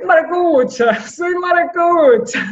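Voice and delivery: playful high shout of pride; proud joking. Real, not acted. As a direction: announce it like a proud local brag. Proudly declares her Zulia roots.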